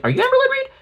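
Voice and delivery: very high, mock fan squeal; high sarcastic fan voice asking; squeaky mock fan.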